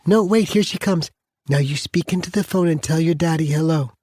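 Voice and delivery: grandmother's voice. Acted. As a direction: coax the little one onto the phone.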